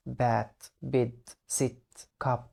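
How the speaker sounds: "بيد" said slowly with a short e; short vowel words. Shown as a demonstration. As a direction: say "بات", "بيد", "سيت", "كاب" short-voweled. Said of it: "اتحبس بين حرفين ساكنين".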